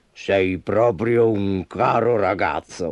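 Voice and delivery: slow, tender tiger purr; purring affection. A narrator's reading. Tiger feeling Sudi is a friend, purring warmly. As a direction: stretch it out fondly, contentedly. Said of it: "La tigre fece le fusa".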